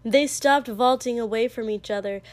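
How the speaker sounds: ordinary delivery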